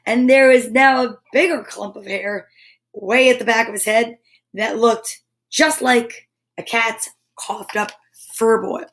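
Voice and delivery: usual speaking voice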